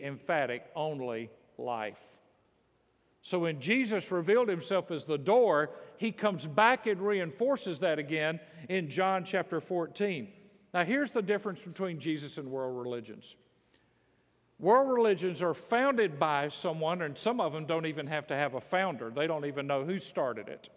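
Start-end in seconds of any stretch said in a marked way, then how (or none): none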